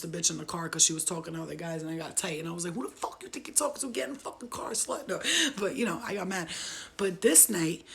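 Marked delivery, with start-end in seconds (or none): 2.7-5.1 fast, higher, hushed scolding; quick high mock tirade; retold jealous anger. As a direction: imitate an angry order, quick and quiet. Acting out her jealous anger.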